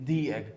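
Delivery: "The egg" with "The" said as "thee"; "thee" before a vowel. Shown as a demonstration. Teacher drills "the" before a vowel sound. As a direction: model "The egg" with a long ee. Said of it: "क्या पढ़ेंगे?".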